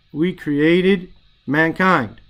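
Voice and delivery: slowly, word by word, stress on created; patient teacher spelling out the meaning; slow explanation. Shown as a demonstration. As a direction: lay out "We created mankind" slowly, leaning on created. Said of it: "helps you to understand and to say the words properly".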